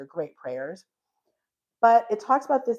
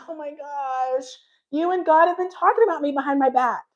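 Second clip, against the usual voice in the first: higher-pitched mock complaint, building louder; exasperated; 6 semitones higher, 5 dB louder than usual. Retelling her reply to the pastor, exasperated. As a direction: accuse them in playful exasperation.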